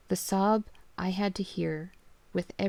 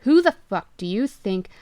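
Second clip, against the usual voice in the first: much louder furious outburst; angry yell; 11 dB louder than usual. Acted. Father shouting at the caller, furious. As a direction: bark at the prank caller.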